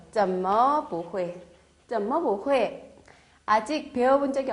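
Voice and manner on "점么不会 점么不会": 怎么不会 drawn out slowly; slow model reading. Shown as a demonstration. Teacher modelling the question, slow and clear. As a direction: lay out each tone slowly. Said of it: "저 어째서?".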